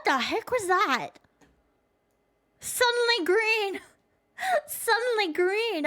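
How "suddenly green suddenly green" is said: mock shock. Real, not acted. Startled by screen turning green.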